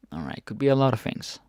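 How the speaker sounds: ordinary talk, nothing marked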